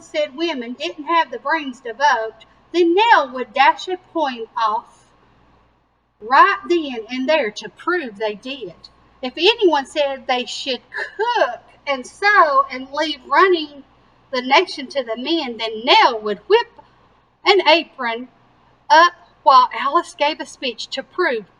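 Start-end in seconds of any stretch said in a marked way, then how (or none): none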